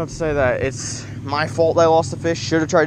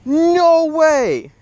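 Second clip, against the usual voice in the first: high, loud cry of disbelief; stunned yell at the lost catch; real shocked outburst; 14 semitones higher, 7 dB louder than usual. Real, not acted. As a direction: blurt it out as the fish breaks free. Fish breaks off the line.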